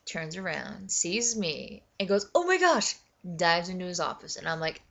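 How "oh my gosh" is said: high, loud mock shock; imitated startled gasp-out. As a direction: exclaim like someone caught off guard. Voicing startled guy seeing him.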